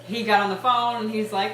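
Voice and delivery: ordinary delivery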